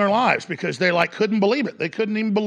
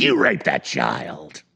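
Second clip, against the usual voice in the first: sinister handler; 2 semitones lower than usual. Retold or imitated. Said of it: "it's like".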